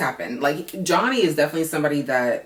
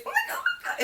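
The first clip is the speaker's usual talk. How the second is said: very high, softer squeal; gushing squeal; 5 dB quieter, faster than usual. Real, not acted. Gushing about the emotional dad in a very high squeal. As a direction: overcome with delight.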